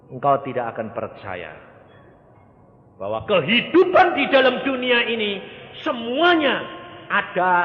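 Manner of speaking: high, loud exhortation; fiery preaching. Acted. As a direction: drive the warning home. Preaching at full force. (khotbah berapi-api)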